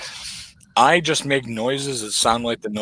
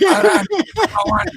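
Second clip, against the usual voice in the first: put-on Iron Sheik accent, "Iran number one" yelled much higher; 4 dB louder than usual. Host topping the bit with a high Iron Sheik yell in his Iranian accent. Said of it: "saying in his accent".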